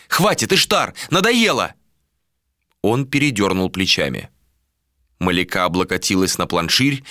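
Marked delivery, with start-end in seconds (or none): 0.1-1.7 higher, fed-up female voice; exasperated woman. A narrator's reading. Woman tells the captain enough, exasperated higher voice. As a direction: protest in a raised pitch. Malika snaps back at Ishtar.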